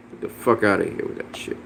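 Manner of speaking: breathy near-whisper brush-off; muttered dismissal. Real, not acted. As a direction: toss it away under your breath. Dismissing a chat comment.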